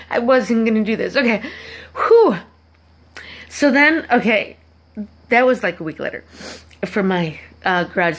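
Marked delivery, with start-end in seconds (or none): 2.0-2.4 high whooshed exhale; loud, high puff of relief; relieved whew. Real, not acted. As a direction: blow out a big high whew to regroup. Composes herself after emotional aside.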